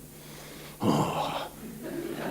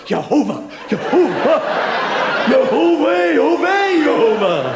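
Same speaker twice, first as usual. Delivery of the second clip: mock ecstatic Jehovah; 6 semitones higher than usual. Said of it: "do they go".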